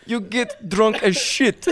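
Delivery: put-on drawn-out comic Russian accent. Playing a Russian partier for friends, Russian accent. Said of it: "he actually can do a fucking Russian accent".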